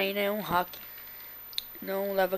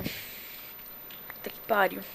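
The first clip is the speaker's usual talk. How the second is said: slow, breathless pained whisper; toothache; 5 dB quieter, much slower than usual. Real, not acted. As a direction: whisper it through tooth pain. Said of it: "meu dente tá doendo demais".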